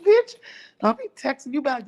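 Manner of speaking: high indignant outburst, imitated; mock scolding. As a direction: open high and sharp, like an offended friend. Acts out Demetra's angry text.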